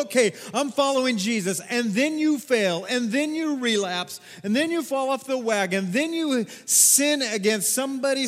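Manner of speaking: loud, high, driving preacher's cry; preaching at full force. Acted. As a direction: preach it out to the whole church. Builds preaching again.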